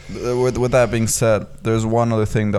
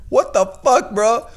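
very high-pitched incredulous outburst; appalled disbelief; 12 semitones higher, slower than usual. Retold or imitated. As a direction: re-enact being floored by an outrageous remark. Retold shock at Hitler remark.

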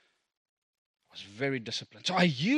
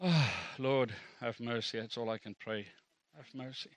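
hushed prayer; 6 semitones lower, 9 dB quieter than usual. Real, not acted. Prayer after confession.

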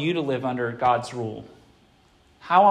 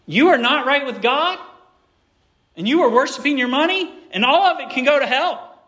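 higher, louder heated rebuke; Peter's fiery curse; 9 semitones higher, 5 dB louder than usual. Retold or imitated. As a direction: blast it out like an outraged apostle. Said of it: "is what Peter's saying here".

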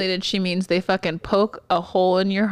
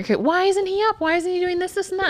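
whiny mock question, much higher; 10 semitones higher than usual. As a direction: parody the nagging staff. Mocking colleagues' complaints.

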